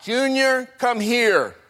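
high, drawn call to another room; mother calling out. Acted. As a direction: call the child in from play. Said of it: "and she calls out".

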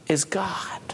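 soft whisper on the key words; hushed whisper, God is the ruler; whispered reveal. Acted. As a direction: drop to a whisper to land it. Sermon climax whispered.

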